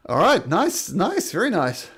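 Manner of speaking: usual speaking voice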